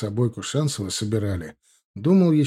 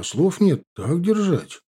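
higher-pitched, stunned praise; mildly astonished approval; dumbfounded; 7 semitones higher, slower than usual. A narrator's reading. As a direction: raise the pitch in amazement. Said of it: "ошарашенно сказал я".

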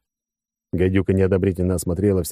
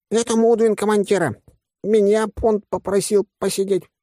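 high-pitched stand-in on watch; silly loudspeaker voice; 13 semitones higher than usual. A narrator's reading. Stand-in on watch answers the commander, silly voice. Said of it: "заорал динамик дурным голосом".

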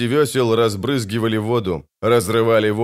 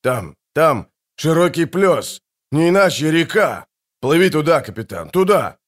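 urgent, higher lookout calls; excited shouting; 7 semitones higher, 3 dB louder than usual. A narrator's reading. Lookout urging captain toward open water, shouting. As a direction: point the way in eager shouts. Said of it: "крикнул с носа Фиш".

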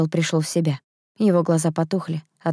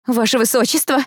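high, anxious appeal; tremulous raised-pitch call; trembling voice; 7 semitones higher than usual. A narrator's reading. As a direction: appeal to the prince nervously. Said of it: "голос которой дрожал".

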